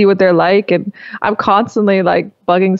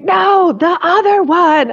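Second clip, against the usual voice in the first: higher, slow exasperated yell; mock shout; 10 semitones higher, slower than usual. Daughter coaching her mom through tech, mock exasperated yell. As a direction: yell it slowly at a clueless parent. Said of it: "I'm like".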